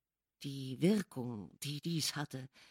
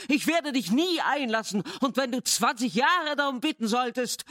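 higher, louder frantic refusal; shouting; 7 semitones higher, 5 dB louder than usual. A narrator's reading. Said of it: "rief ich".

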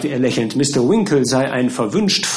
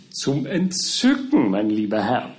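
delighted gushing. Acted. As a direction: relish the praise of the bride. Lawyer gushes over the bride.

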